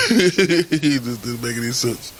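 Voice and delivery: slow words through real laughter; amused laughing. Real, not acted. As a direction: laugh while saying it. Laughing at his own story.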